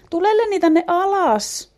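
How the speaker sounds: high, loud, slow call. A narrator's reading. Orvokki calls Lenni down from the branches, high loud call. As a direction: sing out to him up in the tree. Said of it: "hän huutaa".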